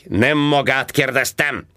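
slow, high barking retort; snapped. A narrator's reading. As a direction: bark it at him. (csattanva, lassan)

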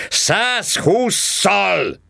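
high, drawn-out yell of the number; roared. A narrator's reading. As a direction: bellow it slowly at the driver. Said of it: "ordította". (ordítva, magasan és elnyújtva)

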